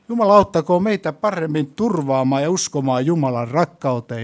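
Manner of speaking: loud, driving preacher voice; sermon at full force; fervent preaching. Acted. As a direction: preach it with conviction.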